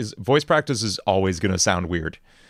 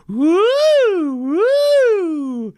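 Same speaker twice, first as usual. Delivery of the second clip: very high, louder falsetto hoots; high vocal warm-up woo; 5 dB louder than usual. Shown as a demonstration. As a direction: sing out high woos like a vocal exercise. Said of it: "practicing these different tones and textures".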